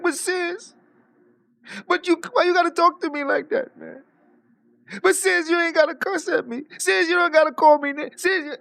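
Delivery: high, rising pleading mock whine; whiny crying man. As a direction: beg like a hurt man in tears. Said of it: "because he like".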